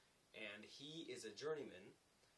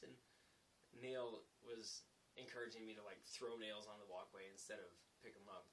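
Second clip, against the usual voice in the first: ordinary talk, nothing marked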